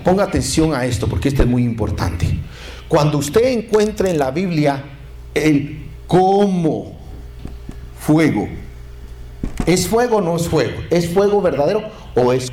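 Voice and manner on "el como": "como" slowly stretched out; stress on COMO. Shown as a demonstration. Preacher pointing out a Bible word, "como" drawn out. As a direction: set "como" apart and draw it long. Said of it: "Cuando usted encuentre la palabra como".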